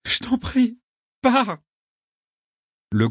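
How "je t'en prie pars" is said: put-on high, urgent girl's plea; tearful pleading. A narrator's reading. Girl begging her friend to go, tearful pleading. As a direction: beg him, pitch raised and strained.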